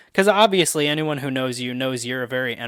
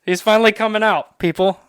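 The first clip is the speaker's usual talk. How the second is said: mock announcement; 8 semitones higher, 7 dB louder than usual. Announcing friend's news to audience.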